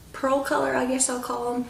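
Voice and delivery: ordinary talk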